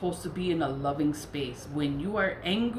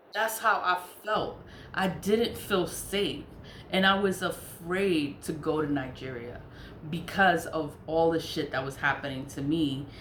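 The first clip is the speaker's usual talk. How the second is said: ordinary delivery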